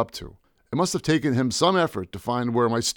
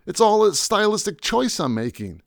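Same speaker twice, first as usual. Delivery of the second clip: pleading; 10 semitones higher than usual. Retold or imitated. Narrator pleads with goons.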